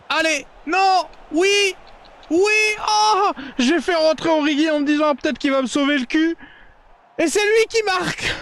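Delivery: high, loud, yelling with joy; excited goal shout. Real, not acted. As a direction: erupt as the goal goes in.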